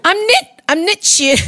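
high-pitched, botched New Zealand accent; hammed-up New Zealand vowels, badly missed; attempted New Zealand accent. Shown as a demonstration. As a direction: try a New Zealand accent, drifting into South African. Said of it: "should I do a New Zealand accent".

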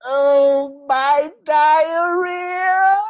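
very slow, high, loud wail; drunk Stu wailing. Retold or imitated. Drunk Stu soiling himself.

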